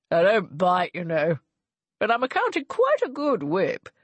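a hint of the smug charmer; amused gentleman. A narrator's reading. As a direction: tease her gently. Gervase amused at Ianthe.

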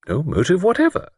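high, emphatic lady's denial. A narrator's reading. Miss Wainfleet denying any known motive, emphatic. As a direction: deny it firmly, pitched high. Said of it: "That was more emphatic".

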